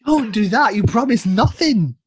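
high, loud mock scolding; mock outrage. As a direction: protest loudly, playing at outrage.

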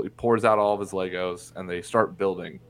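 ordinary talk, nothing marked

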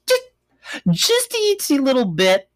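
high, small cutesy voice; a hint of baby talk; itsy voice. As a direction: make it sound tiny. Tiny dip in water.

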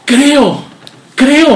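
a father's desperate cry of belief; shouting. Acted. Preacher acting the father crying to Jesus, loud and high. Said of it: "Gritó y dijo".

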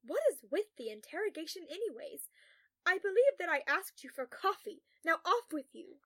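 loud, high, imperious brush-off; exasperated dismissal. A narrator's reading. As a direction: send them away impatiently. Dismissing colleague's questions.

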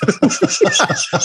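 sudden high burst of laughter. Real, not acted. Telling his friend about the movie, bursting into high laughter.

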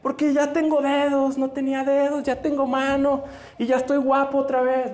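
high-pitched, elated outpouring of thanks; euphoric. Acted. Juan at the feet of Jesus, euphoric. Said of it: "Pero así, eufórico".

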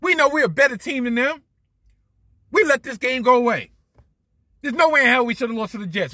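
high, loud and fast, whiny anger; mocking angry quote. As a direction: mimic a sore loser ranting. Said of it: "was pissed off and said".

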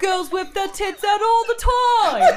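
high, loud, slow fake English accent. Girl from England boasting, in loud fake English accent. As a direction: belt out a hammed-up English accent. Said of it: "My fake English accent?".

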